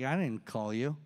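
low, soft reply from someone asleep; sleepy Eli. Acted. Eli to young Samuel at night, sleepy and low. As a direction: answer low, as if half woken. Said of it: "Eli's asleep".

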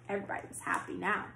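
ordinary delivery, nothing marked

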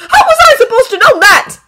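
high, loud angry shout; snapping. A narrator's reading. Boy defending himself to the scolding dragon, snapping. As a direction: snap back at full volume. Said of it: "He snaps".

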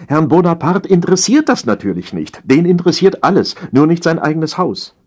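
high-voiced domestic grievance; nagging, aggrieved empress. A narrator's reading.

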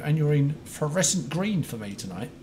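usual speaking voice

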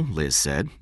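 plain reading voice

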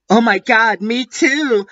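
high-pitched gushing delight; happy. A narrator's reading. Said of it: "I replied happily".

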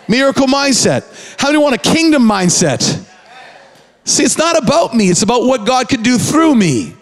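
high, driving sermon cadence; preaching at full force. Acted. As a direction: preach it to the room.

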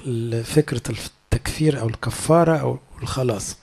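usual speaking voice

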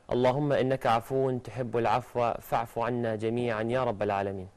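normal talking voice

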